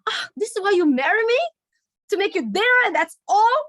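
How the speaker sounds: wife turning the question on her husband, indignant. Acted. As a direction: hurl it back at him, offended. Acted marital quarrel, wife.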